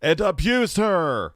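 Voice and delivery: mocking the narrator. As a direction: mimic his overdone voiceover, loud and slow. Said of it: "Why is this guy talking like this?".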